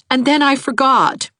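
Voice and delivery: high-pitched sheepish admission; shamefaced. A narrator's reading. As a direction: own up with embarrassment in a high voice. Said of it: "said Anne shamefacedly".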